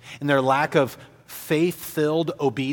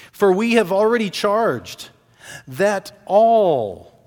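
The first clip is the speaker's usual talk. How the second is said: "all" loud and drawn out; stress on ALL; 5 semitones higher, 5 dB louder than usual. Acted. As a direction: punch "all" hard, high and long. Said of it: "in the Greek it's emphatic".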